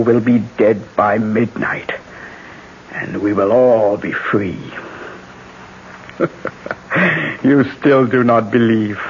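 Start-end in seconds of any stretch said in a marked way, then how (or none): 6.2-7.4 a soft laugh to herself; soft gloating chuckle. Acted. Triumph over the doomed dictator.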